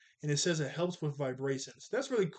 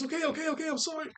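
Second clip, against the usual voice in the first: very high, comic frantic pleading; panicked apology; 13 semitones higher, faster than usual. Retold or imitated. Aang pelted with firecrackers by Momo, panicked apology. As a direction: squeal out a mock panicked apology.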